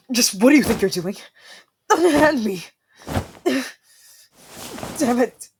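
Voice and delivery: loud, high indignant protest; indignant struggle. Acted. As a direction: demand to be let go. Struggling against a captor.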